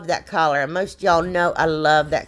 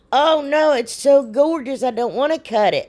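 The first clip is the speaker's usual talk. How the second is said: mock dismay; 6 semitones higher than usual. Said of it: "But I'm like".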